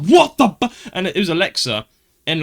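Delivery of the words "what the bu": loud, high furious yell; angry shout. Retold or imitated. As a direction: blast it out, jolted awake and furious. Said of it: "I got so angry".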